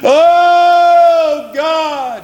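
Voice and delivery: high, pleading cry to God, voice breaking; high anguished wail, rising to a shout; anguished crying prayer. Retold or imitated. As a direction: cry out like a man in agony. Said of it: "Did you ever picture Jesus crying unto God?".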